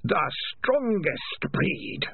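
very slow, high creature voice; voice of Spider. A narrator's reading. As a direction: draw every word right out, high and eerie. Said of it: "said the voice of Spider".

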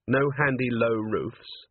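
plain reading voice

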